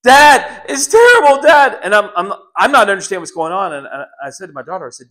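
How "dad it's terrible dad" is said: high, loud panicked shouts; retold screaming. Daughter screaming down the phone to her dad. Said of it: "And she's screaming".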